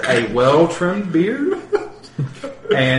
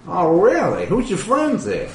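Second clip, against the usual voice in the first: higher, lightly voiced curious question; curious dwarf boss; 7 semitones higher than usual. Crime boss dwarf asking Donar about his friends, curious. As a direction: ask about the strangers with interest. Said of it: "he says".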